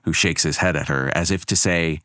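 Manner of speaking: ordinary delivery, nothing marked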